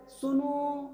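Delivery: सुनो with the final ओ held long; drawn-out call. Shown as a demonstration. Teacher showing the class a prolonged vowel, सुनो stretched out. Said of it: "कुछ देर तक इसका उच्चारण चलता रहता है".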